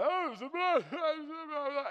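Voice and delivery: high, loud mock-frantic daughter babbling; mock hysterical daughter. Father mimicking his upset daughter, high and frantic. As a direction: ham up a panicky teen's rant.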